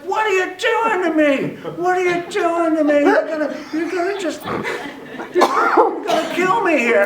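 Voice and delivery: very high, loud accusing cry; high-pitched mock hysteria; panicked mock yelling. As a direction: imitate a frightened woman shouting accusations. Woman yelling accusations, acted.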